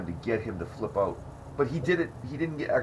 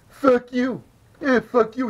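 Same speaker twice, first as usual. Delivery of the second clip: much higher, much louder mock shouting of fuck you; Paul yelling back; 14 semitones higher, 8 dB louder, slower than usual. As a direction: blast the insult straight back at the heckler. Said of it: "And Paul's like".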